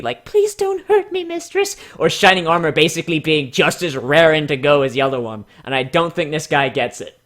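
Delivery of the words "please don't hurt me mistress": very high, timid plea; wimpy. Retold or imitated. Mocking wimpy replies, a very high timid plea. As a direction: beg like a frightened servant. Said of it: "very wimpy".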